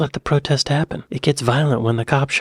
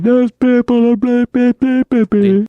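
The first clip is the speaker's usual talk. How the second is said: high, loud sneering mock voice; mocking Conservatives; 12 semitones higher, 8 dB louder than usual. Mocking the Conservatives' dismissal of fans, high and loud. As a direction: ham up a dismissive Tory blah-blahing. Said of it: "the Conservatives are like".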